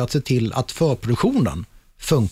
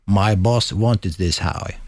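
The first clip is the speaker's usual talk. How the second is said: slow, lower English line, lightly voiced stagehand; mock guitar tech; 5 semitones lower, much slower than usual. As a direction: drag out the excuse like a stubborn roadie. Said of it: "Och bara". (långsamt, lätt spelad roddare)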